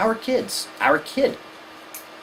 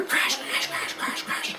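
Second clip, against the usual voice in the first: whispered crash noise; 9 dB quieter, slower than usual. Retold or imitated. Imitated icicle noise.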